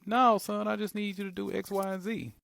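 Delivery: high, loud mother voice; mom voice. Retold or imitated. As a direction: mimic mom's high urgent voice. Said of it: "She'd be like".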